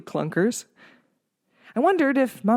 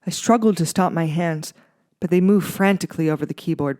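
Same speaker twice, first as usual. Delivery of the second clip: usual speaking voice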